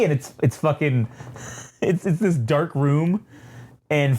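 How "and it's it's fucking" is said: ordinary delivery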